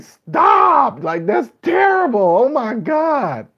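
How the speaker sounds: high mock outburst of disgust; yell at them. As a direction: scold the fake reactors, hammed up. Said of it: "I just wanna yell at them".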